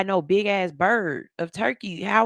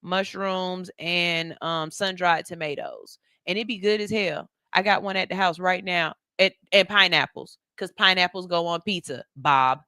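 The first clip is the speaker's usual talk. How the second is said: ordinary delivery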